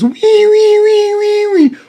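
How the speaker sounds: very high, wailing mock sobs; mock baby crying. Mocking Nick Fuentes as a crybaby, high mock wail. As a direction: wail like a bawling infant to mock him. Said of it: "I need to hear him crying like a baby".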